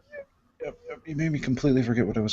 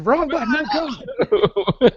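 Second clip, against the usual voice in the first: even higher mock alarm; mock panic; 13 semitones higher, much slower than usual. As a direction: yelp it again, more frantic. Repeated higher.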